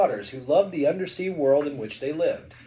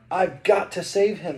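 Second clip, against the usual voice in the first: raised, slightly higher urgent voice; mild shout; 4 semitones higher, 5 dB louder than usual. A narrator's reading. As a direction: read it as a restrained shout. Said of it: "shouted Ariel".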